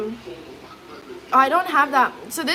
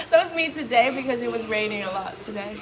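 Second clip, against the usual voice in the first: laughing; 2 semitones higher than usual. Real, not acted. Laughing at own photo.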